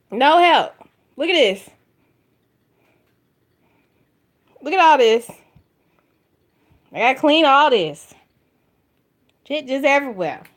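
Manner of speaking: exasperated. Real, not acted. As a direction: vent loudly at the mess. Exasperated about cleaning mess.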